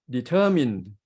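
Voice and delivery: "determined" with the middle syllable TER stretched and lifted; de-TER-mined, middle beat strongest; stress on the middle syllable. Shown as a demonstration. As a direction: lean hard on TER in "determined". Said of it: "we stress at the middle syllabus".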